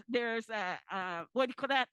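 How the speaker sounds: ordinary talk, nothing marked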